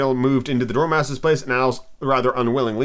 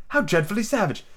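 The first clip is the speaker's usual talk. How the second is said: high-pitched dismayed exclamation; lightly acted shock; exclaiming; 8 semitones higher than usual. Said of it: "exclaimed Alice".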